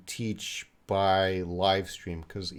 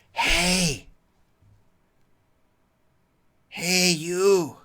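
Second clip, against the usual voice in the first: high, hissed half-whispered shout; 9 semitones higher, 5 dB louder, much slower than usual. Shown as a demonstration. Teacher showing a student what whisper shouted means, whisper shout. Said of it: "that might be a whisper shout".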